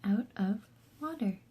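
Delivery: breathy hushed title read; whispered title. Acted. Reading the title to a child, whispered. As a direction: whisper the book title softly.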